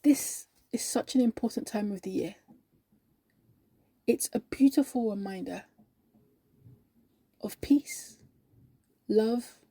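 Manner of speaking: hushed, heartfelt testimony with long pauses; reverent sincerity. Acted. Believer telling colleague why Christmas matters, reverent. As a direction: let each phrase settle softly.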